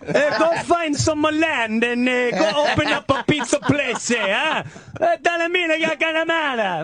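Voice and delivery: high, hammed-up Italian immigrant accent; mock Italian immigrant. Neighbor mocking pizza guy fresh off the boat, high cartoon Italian accent. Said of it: "he fell off the boat".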